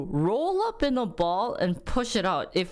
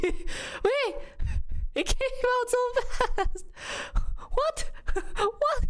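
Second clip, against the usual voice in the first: very high, laughing what; laughing disbelief; slower than usual. Real, not acted. Mom laughing at how fast the birth was. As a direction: giggle in amazement. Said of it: "laughing disbelief".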